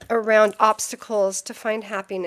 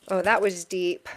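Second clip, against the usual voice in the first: ordinary delivery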